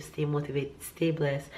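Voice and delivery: usual speaking voice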